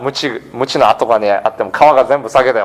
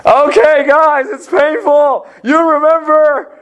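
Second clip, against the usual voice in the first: much higher, mock menacing threat in a put-on voice; vengeful threat; 12 semitones higher, slower than usual. Preacher imagining himself on the cross threatening enemies, hammed-up menace. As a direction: ham up a revenge promise, half joking. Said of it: "I would say".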